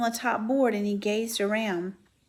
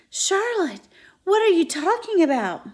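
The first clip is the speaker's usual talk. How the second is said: high, loud pleading alarm; alarmed cry; 9 semitones higher, 8 dB louder than usual. Acted. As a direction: cry out her name in shock. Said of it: "he cried".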